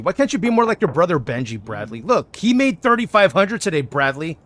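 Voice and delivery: loud pretend telling-off; mock scolding. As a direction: nag like a disappointed parent, hammed up. Acted parental nagging of Bradley.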